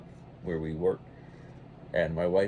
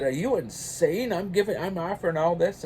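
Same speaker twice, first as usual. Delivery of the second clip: a raised, mock-indignant manager, pitched higher; retold angry manager; 9 semitones higher than usual. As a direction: play the shocked, offended manager. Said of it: "he got mad".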